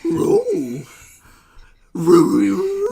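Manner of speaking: very high loud wailing howl; Scooby-Doo howl. Acted.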